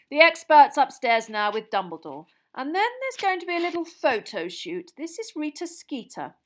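a jolly official welcoming at full volume; booming Bagman. Acted. Jolly official loudly welcoming Harry into the room, booming and hearty.

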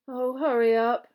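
loud, slow, drawn-out moan. A narrator's reading. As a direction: moan it with impatience. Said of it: "Ron moaned".

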